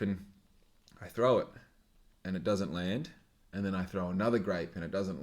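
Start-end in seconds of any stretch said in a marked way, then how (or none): none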